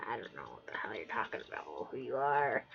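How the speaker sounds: fast, low whispered inner thought. Retold or imitated. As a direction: mutter under breath. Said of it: "She's just like".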